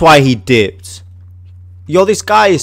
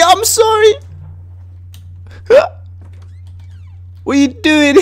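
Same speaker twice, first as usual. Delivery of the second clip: much higher, cartoonish sorry; squeaky mock apology; 4 dB quieter than usual. Gamer mock-apologizing to his annoyed teammate in a squeaky voice. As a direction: pipe it up like a silly little voice.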